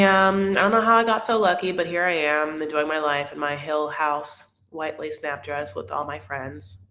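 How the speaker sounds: normal talking voice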